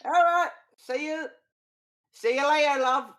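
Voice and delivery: hammy Cockney barker greeting visitors; terrible Cockney barker. Acted. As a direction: bellow it like a bad Cockney showman. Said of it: "with a terrible accent".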